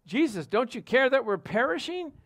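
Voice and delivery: mock desperate appeal over a storm; retold alarmed plea. Apostles plead with Jesus in the storm, imitated alarm.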